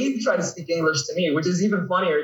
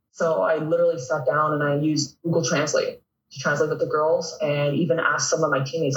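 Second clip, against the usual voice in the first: usual speaking voice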